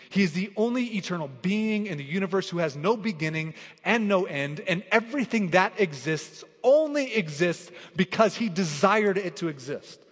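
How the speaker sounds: loud, driving sermon build; full-force preaching. Acted. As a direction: preach it out to the congregation with rising force.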